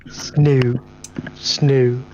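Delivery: "Snoo" with a playful, hammed-up Australian vowel; mock Australian accent. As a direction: tease the Australian "snow", lightly overdone. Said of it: "So the Australian accent is back".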